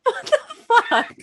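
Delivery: very high shocked what the fuck into a laugh. Real, not acted. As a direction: squeal it in disbelief then laugh. Shocked reaction to quote.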